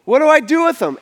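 high-pitched, incredulous appeal; worked-up, disbelieving questions; exasperated questioning. Retold or imitated. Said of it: "So Pilate asks him, he says".

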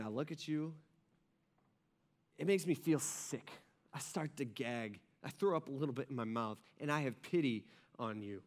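low, softened voice, pausing between phrases; subdued, grave revulsion; quiet disgust. Retold or imitated. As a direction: voice Jesus's pity in a hushed rebuke. Voicing Jesus's disgust and pity.

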